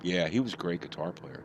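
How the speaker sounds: ordinary delivery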